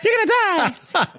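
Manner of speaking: very high squawky bird voice; mocking high-pitched bird talk; taunting bird. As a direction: jeer like a cawing bird. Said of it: "The bird's sort of like".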